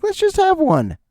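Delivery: very high squealed suggestion; giddy temptation. Acted. Shopper unloading groceries, tempted high squeal. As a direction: pitch it way up, giving in to temptation. Giving in to chips while unloading.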